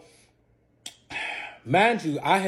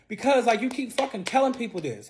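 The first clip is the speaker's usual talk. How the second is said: exasperated complaint; 9 semitones higher, 4 dB louder than usual. Retold or imitated. Borderline arguing with fiance.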